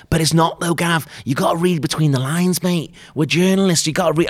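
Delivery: high, insistent imitation northern English accent; bad northern accent. Colleague urging Gav to read between lines, bad northern accent. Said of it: "that's not good accent".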